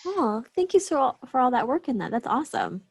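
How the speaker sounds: ordinary talk, nothing marked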